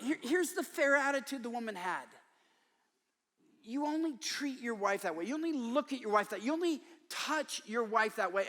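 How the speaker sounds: ordinary delivery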